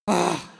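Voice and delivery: loud high "ah!"; a jolt of shock; startled yelp. Real, not acted. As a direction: speaker struck by the superintendent's question, loud cry. Stunned by the question.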